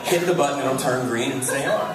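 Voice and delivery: ordinary talk, nothing marked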